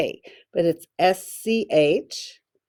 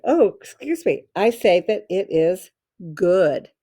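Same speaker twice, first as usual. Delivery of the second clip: ordinary talk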